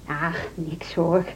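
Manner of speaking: slow soft reassurance; calm the child down gently; reassuring. Acted. Teacher to pupil, soft and reassuring.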